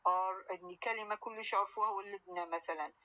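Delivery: normal talking voice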